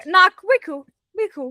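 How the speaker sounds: lightly voiced Becky calling out, high; mock sing-song call. Becky voiced in a retold scene.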